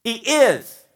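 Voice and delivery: "is" high, loud and held; emphatic IS. Shown as a demonstration. Preacher showing John's emphasis, emphatic "is". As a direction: hit "is" with full force.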